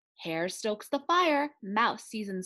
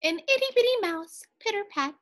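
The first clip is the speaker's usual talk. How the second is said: high and quick for the little mouse; tiny mouse voice; 11 semitones higher, 4 dB louder, faster than usual. A narrator's reading. Narrator reading to children, high tiny mouse. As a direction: squeak it out small and fast.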